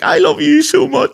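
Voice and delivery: high-pitched gushing fan, hammed up; mock-adoring squeal of a besotted girl; imitating smitten coworker. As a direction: gush it high, sending her up. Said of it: "she's like".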